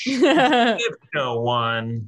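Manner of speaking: excited announcement. Real, not acted. Announcing the giveaway winner.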